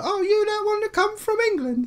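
very high mock girly voice; American girl. Girl greeting the visiting Englishman, high mock girly voice. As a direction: squeal it like an impressed American girl, sent up. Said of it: "the girl was just like".

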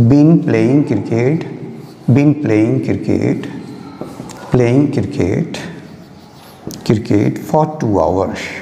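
very slow, drawn-out phrases for the board; slow dictation. Shown as a demonstration. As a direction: stretch every word as you write. Teacher dictating while writing. (बहुत धीमे लिखवाते हुए)

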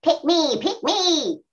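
a hint of eager alien chant; toy alien voice. Shown as a demonstration. Alien toys begging to be picked, light alien chant. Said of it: "the alien".

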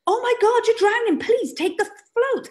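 high, exasperated plea to grab the float; pleading. Pretend. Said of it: "And you're like".

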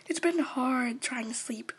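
soft whisper about not sleeping; sleepy whisper. Acted. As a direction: confide it in a hush at night. Sleepy night host confides.

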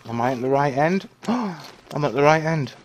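rising panic, run for it; being chased. Real, not acted. Sees track, flees in game.

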